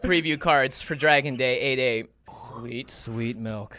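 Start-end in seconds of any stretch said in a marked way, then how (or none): 2.6-3.8 slow soft relief; relieved. Real, not acted. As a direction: savor the relief slowly. Relief drinking milk.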